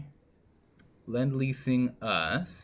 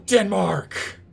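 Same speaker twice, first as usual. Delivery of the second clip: Denmark! cried out high and loud; outraged cry; 10 semitones higher, 9 dB louder than usual. Real, not acted. Gamer calls out Denmark for joining the enemy, outraged yell. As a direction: yell the name like a betrayal. Denmark turns on him in game.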